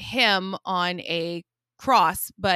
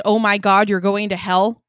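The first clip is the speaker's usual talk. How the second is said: loud exclaim; 9 dB louder than usual. Retold or imitated. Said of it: "and I said".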